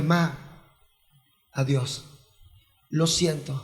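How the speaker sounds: soft, low goodbye; tender farewell. Retold or imitated. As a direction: speak it gently into her ear. Last farewell to dead mother.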